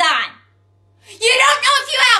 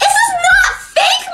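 much higher, slow, half-laughing protest shout; indignant teen shout; 12 semitones higher, 3 dB louder, slower than usual. Acted. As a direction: wail it like the crystals were insulted. Teen defends crystals to mom.

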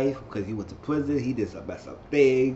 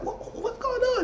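mock bystanders; slower than usual. Everybody voiced, high.

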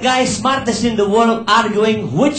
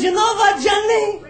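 a much higher mock wife's voice; 10 semitones higher than usual. Old wife teasing her husband at the mirror, higher mock voice.

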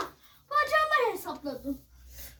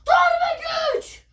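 high, loud power-up yell; shouted battle cry; 8 semitones higher, 5 dB louder than usual. Pretend. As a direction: yell it like a superhero. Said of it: "turbo power chant, shouted take".

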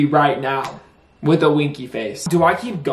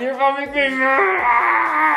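mock angry whine; 10 semitones higher than usual. Mock anger at girlfriend.

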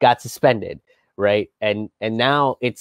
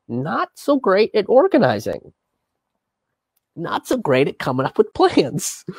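sarcastic; 7 semitones higher than usual. Acted. Mocking the establishment.

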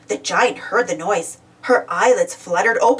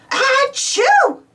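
big high storybook sneeze; acted sneeze; 4 dB louder than usual. A narrator's reading. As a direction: sneeze it out loud as a word. Friends had to sneeze.

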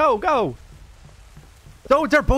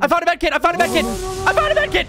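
excited; 10 semitones higher, faster than usual. Real, not acted. Excited find in horror game.

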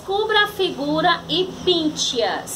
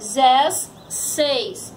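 "dezesseis" slowly broken into de, zes, seis; sílabas separadas; much slower than usual. Shown as a demonstration. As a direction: leave gaps inside "dezesseis". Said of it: "na separação de sílaba". ("dezesseis" separado, bem devagar)

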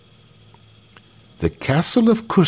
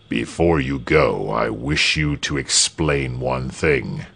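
lower, controlled governor voice; low-pitched voice of authority; stern governor; 6 semitones lower, 3 dB quieter than usual. A narrator's reading. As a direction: speak down as a man in command.

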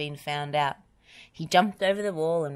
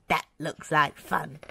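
plain reading voice

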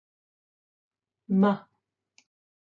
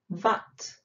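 ordinary talk

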